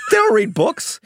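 high, amused scoff at the idea. Retold or imitated. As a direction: toss it off like it's obvious. Billy scoffing on the phone.

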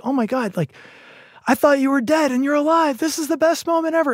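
high-pitched imitation of joyful relief; relieved, overjoyed. Bill finds his friend alive, imitated overjoyed relief. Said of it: "relieved and overjoyed".

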